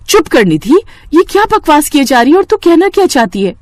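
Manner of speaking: loud, sharp rebuke; scolding. Acted. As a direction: cut her off hard. Priya scolding Nidhi.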